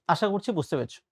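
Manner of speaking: ordinary talk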